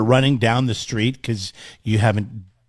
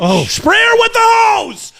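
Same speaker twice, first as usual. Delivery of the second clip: much higher, louder, slow outraged yell; indignant shout; 5 dB louder, slower than usual. Real, not acted. Cohost reacts to the nosy neighbor, slow high outraged shout. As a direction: belt it out in mock outrage.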